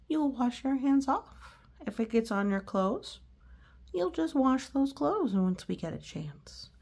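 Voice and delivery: ordinary delivery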